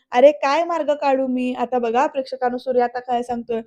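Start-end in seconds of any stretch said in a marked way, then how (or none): none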